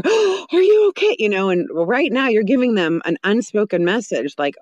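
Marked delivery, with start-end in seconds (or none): 0.1-1.2 gasp, then a high, louder fussing cry; mock alarm. Adults running to a fallen child, in exaggerated alarm. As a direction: play the overreacting adult rushing over in panic.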